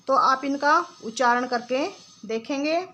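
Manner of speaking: normal talking voice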